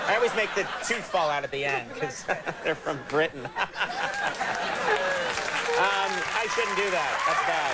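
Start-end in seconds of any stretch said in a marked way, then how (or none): 3.5-5.7 high, helpless burst of laughter; laughing. Real, not acted. Host amused by own Cockney, laughing. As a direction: crack up at your own joke. Cracking up at own bad impression.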